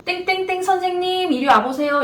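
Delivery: a senior's ominous summons; summoning senior nurse. Retold or imitated. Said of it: "가장 무서웠던 이야기". (신규를 불러 세우는 선배의 불길한 부름)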